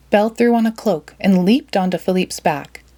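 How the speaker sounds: ordinary delivery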